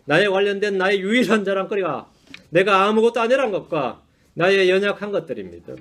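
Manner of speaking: ordinary talk, nothing marked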